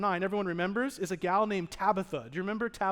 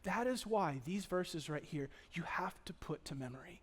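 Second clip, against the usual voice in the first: earnest whisper; 4 semitones lower, 10 dB quieter than usual. Real, not acted. Pastor urges young women to memorize, whispering. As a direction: lean in and whisper it like a secret.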